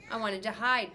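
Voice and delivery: usual speaking voice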